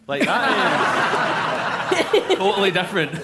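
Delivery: can't believe it, cracking up; incredulous laughing objection; laughing protest. Real, not acted. Laughing at a wrong story.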